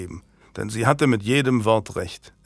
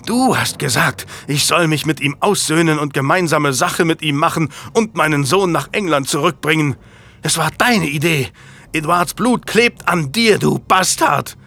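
high, loud, raging accusation; furious accusation; 5 semitones higher, 4 dB louder than usual. A narrator's reading. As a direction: hurl the blame at him. Marguerite accuses Julian, beating him.

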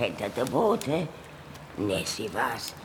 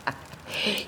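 airy unvoiced chuckle; a soft breathy laugh; breathy giggle; 4 semitones higher, 5 dB quieter than usual. Monster laughs at the whispered wish.

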